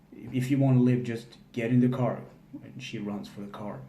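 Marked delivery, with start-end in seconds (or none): 0.1-2.2 louder, urgent call to get in; urgent shout. Retold or imitated. John urging Kate into the car, loud and urgent. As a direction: bark it like a getaway order. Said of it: "John says".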